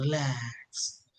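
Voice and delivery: soft, low, slow relax; soothing relax. Shown as a demonstration. Teacher softly telling class to relax. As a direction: breathe out relax slowly and softly. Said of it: "मेरी tone में वो feeling".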